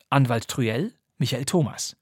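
usual speaking voice

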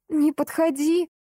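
high slow shriek. Acted. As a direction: scream to keep him away. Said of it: "завизжала только громче".

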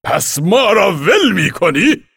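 high, loud, slow shouted question; indignant shout. Acted. Commander shouts at the boy for abandoning them, slow loud. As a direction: hurl the question up at him. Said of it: "سردار فریاد زد".

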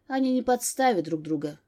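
usual speaking voice

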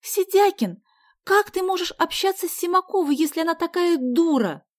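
put-on high, loud girl's scolding; exasperated outburst. A narrator's reading. Sonya scolding Vasya over her rival, exasperated. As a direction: demand an explanation indignantly.

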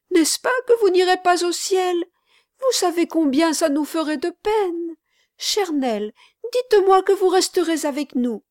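put-on urgent childlike entreaty; pleading little boy. A narrator's reading. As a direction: implore as an anxious small boy. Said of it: "s'écria le petit garçon".

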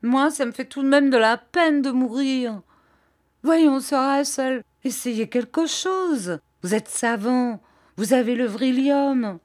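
put-on high, whimpering child-like plea, slowed on Voyons; a grown man whining like a little boy; Bobby begs Sir Hassel, whiny and high like a child. A narrator's reading. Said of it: "pleurarde comme celle d'un enfant".

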